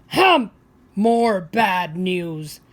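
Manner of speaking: loud scoff, then very slow grumble; huff, then drag out the complaint; grumpy old man. A narrator's reading. Grumpy old man scoffing at the news seller, loud and slow. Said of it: "says the grumpy old man".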